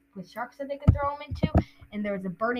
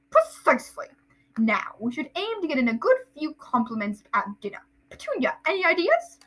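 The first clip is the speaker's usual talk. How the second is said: lightly voiced pompous uncle; Uncle Vernon; 5 semitones higher than usual. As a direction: chair the meeting self-importantly. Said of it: "that's Vernon".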